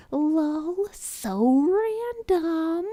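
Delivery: high, loud squeaky mock voice; mock lol-so-random voice. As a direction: parody a hyper random kid. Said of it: "bad non sequitur humor of".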